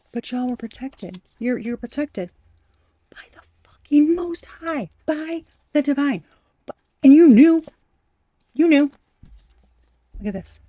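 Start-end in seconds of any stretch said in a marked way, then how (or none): 3.1-6.2 high hushed whisper of awe; awed whisper. Acted. Reader whispering about divine protection. Reverent whisper during tarot reading. 6.7-8.9 high, loud, insistent; emphatic exclaim. Real, not acted. Tarot reader insisting the viewer knew.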